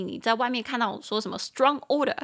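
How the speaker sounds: ordinary talk, nothing marked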